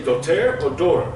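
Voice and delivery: "doter" then "daughter", each said loudly and distinctly; accent contrast. Shown as a demonstration. Teacher asking students which they say, loud contrasted pronunciations. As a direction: set "doter" against "daughter" with different vowels. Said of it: "emphasis and the speed".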